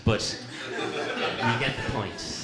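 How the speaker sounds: normal talking voice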